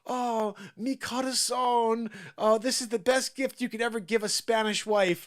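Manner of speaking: slow, gushing fake Spanish; fake Spanish accent. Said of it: "she's like".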